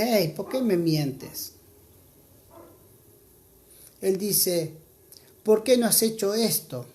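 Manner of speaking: ordinary talk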